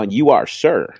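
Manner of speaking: ordinary talk, nothing marked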